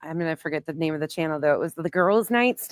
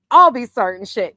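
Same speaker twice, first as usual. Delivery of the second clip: louder, hammed-up threat of starting shit; mock troublemaker boast; 3 semitones higher, 8 dB louder than usual. Streamer teasing her chat, mock boastful loud. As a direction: play the proud stirrer, tongue in cheek. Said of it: "Just joking".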